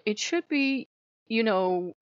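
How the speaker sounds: normal talking voice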